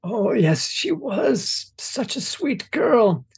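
put-on high crooning old hag. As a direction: coo it like an old grandmother. Grandmother Wisp answers Zamir.

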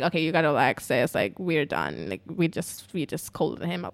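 low, softened cop voice; mock a cop telling someone to relax; retold low, quiet reassurance; calming cop. Voicing the cop calming her down.

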